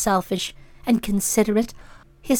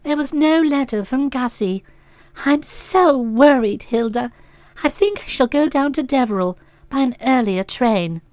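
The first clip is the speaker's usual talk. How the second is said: worried tremor; 3 semitones lower, 4 dB quieter than usual. Acted. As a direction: let the worry shake the voice a little. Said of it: "one spotted the tremor in the voice".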